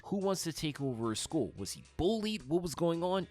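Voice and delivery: ordinary delivery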